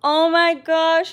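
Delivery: high, loud, drawn-out oh my gosh; thrilled exclamation; excited gasp. Real, not acted. Reacting to a viewer from the UK, high and thrilled.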